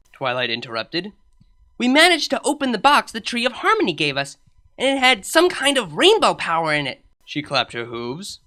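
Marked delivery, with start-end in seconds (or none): 1.8-7.0 a slightly higher, eager voice; lightly voiced young mare. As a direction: share the news with growing enthusiasm. Character voice for Twilight.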